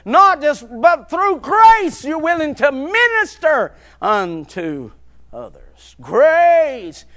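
loud, rising sermon cadence, punching each phrase; preaching at full force. Acted. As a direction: preach it to the back pews.

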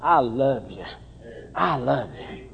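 soft, hushed words of love; tender. Retold or imitated. Joseph softly telling his brothers he loves them, tender. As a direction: say it gently to the brothers. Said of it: "Joseph wanted them to know".

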